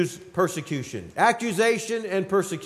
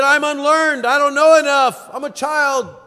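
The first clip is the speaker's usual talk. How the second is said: higher and louder, anxious pleading; Jeremiah's excuse; 5 semitones higher, 5 dB louder than usual. Retold or imitated. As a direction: protest your own inadequacy. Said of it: "Jeremiah said".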